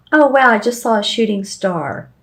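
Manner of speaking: ordinary talk, nothing marked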